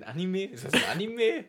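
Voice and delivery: high disbelieving question; surprised. Real, not acted. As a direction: ask it in sudden disbelief. Ungläubige Nachfrage. (überrascht)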